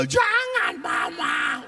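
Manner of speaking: very high, hammed-up child's wail; mock wailing plea. Imitating children wailing for mother. (meratap melengking menirukan anak)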